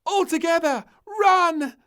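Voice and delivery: very high, loud rallying cry. A narrator's reading. Princess leading everyone into the sea, loud high. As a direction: yell them into the water. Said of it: "cried little princess".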